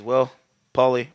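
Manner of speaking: menacing low quip. Retold or imitated. Villain quip before throwing him.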